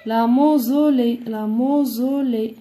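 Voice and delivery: slowly stretched syllables, dictation style. Shown as a demonstration. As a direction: draw the word out for pupils. Teacher repeats the word slowly. (dictée lente, syllabes étirées)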